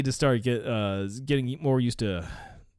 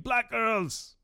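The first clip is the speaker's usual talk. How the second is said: mock scream; 9 semitones higher than usual. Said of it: "and screamed".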